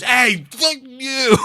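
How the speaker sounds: mock tough guy taunt; cable guy's growl. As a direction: drawl it out as the rude cable guy. Retold cable guy insult.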